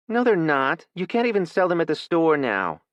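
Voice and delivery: put-on a child contradicting her mother; Kylie talking back. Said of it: "Kylie said".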